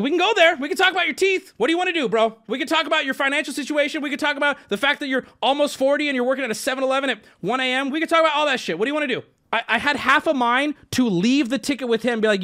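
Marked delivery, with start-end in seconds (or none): 0.0-9.2 raised, higher challenging rant; heated imitated tirade; confrontational. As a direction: square up and lay into him. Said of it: "I'm like".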